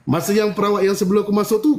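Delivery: ordinary delivery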